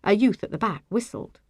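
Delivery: ordinary delivery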